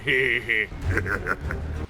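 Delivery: throaty orc chuckle; orc laugh. Shown as a demonstration. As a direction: let out a sly orc snicker. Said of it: "you're gonna hear that".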